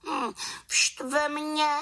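very high, slow sulk; high-pitched, drawn-out pout; annoyed little witch. A narrator's reading. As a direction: grumble as a small cross witch. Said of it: "řekla malá čarodějnice".

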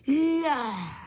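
high, drawn-out gasp of wonder; amazed exclamation. A narrator's reading. All the bugs see the little cart, crying out amazed. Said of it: "zvolali všichni s údivem".

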